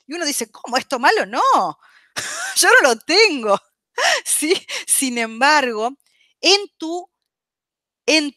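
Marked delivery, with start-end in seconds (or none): 0.5-3.6 mock indignant denial.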